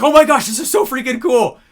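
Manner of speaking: loud, high thrilled gush; excited client. Retold or imitated. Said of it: "everyone's like".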